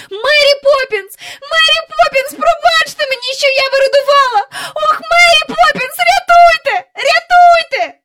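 very high, loud, tearful cries; panicked child's shrill calling; screaming for help. Acted. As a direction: scream desperately for rescue, building to the end. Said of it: "вона закричала так, що луна пішла".

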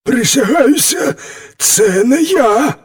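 high-pitched wolf swearing innocence; high, emphatic storybook wolf; defensive wolf. A narrator's reading. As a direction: protest hotly in a high animal voice. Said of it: "вищерив зуби вовк".

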